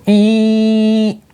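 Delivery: short high alarm beep imitation; shrill alarm squeal. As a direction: shoot up into a piercing alarm squeal. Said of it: "high-pitched squealing ee".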